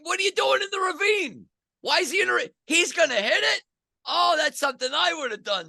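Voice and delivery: high, strained cry at the TV; retold disbelief. Watching his golfer on TV, high incredulous yelling. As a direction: yell at the screen in disbelief. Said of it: "and I'm like".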